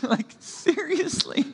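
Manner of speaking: mock whimper, breathy then jumping high; hammed-up pleading with his disciples; half-whispered mock despair with high squeaks; whimpering Jesus. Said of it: "with a whimper in his voice".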